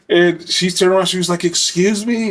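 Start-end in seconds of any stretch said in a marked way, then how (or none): 1.4-2.3 high, offended challenge; officer rounding on the inmate, indignant; indignant excuse me. Retold or imitated. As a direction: call him out, affronted. Officer confronts the catcalling inmate.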